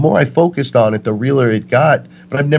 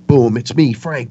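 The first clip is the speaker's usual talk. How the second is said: normal talking voice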